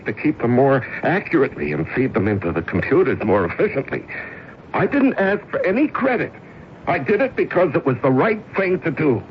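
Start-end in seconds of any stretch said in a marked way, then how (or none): 4.7-9.2 much higher, defiant resolve building; fervent vow. Acted. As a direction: swear you'll get it back. Vow to regain job.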